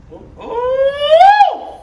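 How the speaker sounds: a high delighted squeal with a laugh; excited squeal. Real, not acted. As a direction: shriek with joy at the food.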